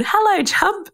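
high, bright call out to the sea; tender high hello to the ocean; sing-song greeting. Real, not acted. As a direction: greet him lovingly, lifted up high. Waving at the ocean.

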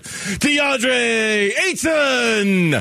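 long drawn-out arena announcer call; echoing stadium announcer. Retold or imitated. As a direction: stretch the name like a PA announcer booming it. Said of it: "the Nuggets P A announcer".

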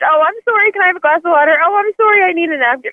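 high-pitched, polite over-apologizing; over-apologetic. Retold or imitated. Caller mocking her own needless sorries, high and over-apologetic. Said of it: "one of those that apologizes way too much".

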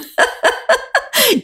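high-pitched burst of laughter; self-deprecating laugh. Real, not acted. Laughs at own name blunder. (lys latter av seg selv)

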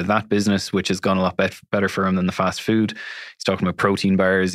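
ordinary talk, nothing marked

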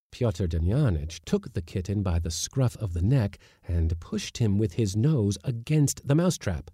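plain reading voice

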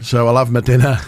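usual speaking voice

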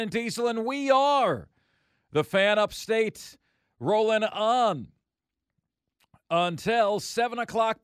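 loud, hyped radio host opener; radio host intro. Acted. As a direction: pump up the show open like a DJ. Radio show opening hype.

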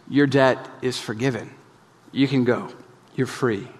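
soft, gentle king's release; a merciful king speaking softly; tender pardon. Acted. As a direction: let him go kindly and quietly.

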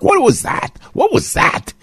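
very high-pitched mocking disbelief; incredulous. Real, not acted. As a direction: squeak the question, appalled. Mocking disbelief at SNL appearance.

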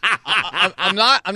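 laughing at the gloomy call; can't stop chuckling. Real, not acted. Reacts to dejected call.

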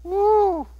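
high drawn-out "woo"; one long high whoop of amazement; amazed whoop. Real, not acted. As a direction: let out a high, impressed woo. Reacts to the huge debt.

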